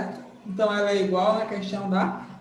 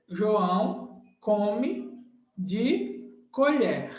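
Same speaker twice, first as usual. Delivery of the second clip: slow demo; much slower than usual. Shown as a demonstration. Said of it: "slow demo sentence".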